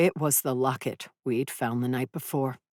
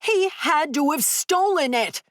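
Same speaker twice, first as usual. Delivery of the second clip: high furious roar; 13 semitones higher, 3 dB louder than usual. Acted. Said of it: "I roared".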